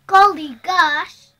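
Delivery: loud, slow startled exclamation; surprised farmer. Acted. Farmer waking to the splash, loud slow surprised exclaim. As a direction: make the farmer jump awake, loudly.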